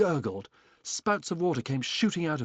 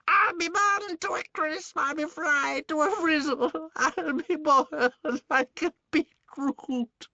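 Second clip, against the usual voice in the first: high, slow crone's wail with a cackle; old hag wailing; 6 dB louder, slower than usual. Acted. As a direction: wail in mock doom like a witch. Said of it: "she yelled".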